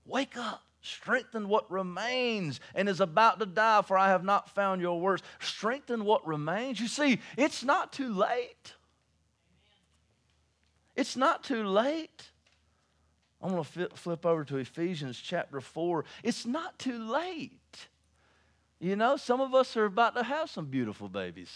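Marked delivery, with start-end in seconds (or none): 0.0-12.3 high, intense sermon delivery; pleading preacher, high and slow on the last lines; preaching. Acted. Preaching to congregation. 16.2-21.5 preaching. Acted.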